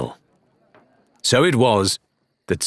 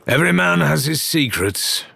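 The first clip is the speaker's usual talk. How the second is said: growled; 8 semitones higher than usual. Acted. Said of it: "Ramsay growled".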